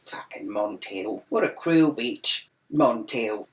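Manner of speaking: low, slow inner grumble; scornful private thought. Retold or imitated. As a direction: sour aside to oneself, kept down. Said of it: "And I thought".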